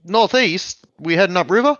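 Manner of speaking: captain doubting the navigator, high and loud; surprised captain. Acted. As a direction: rise up in disbelief at the order. Captain questions wrong direction.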